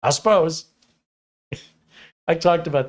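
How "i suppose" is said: high, loud mock Midwest accent; imitated Upper Midwest parting phrase; Midwest goodbye. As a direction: announce you're leaving, Midwest style. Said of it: "That's how you say you're leaving".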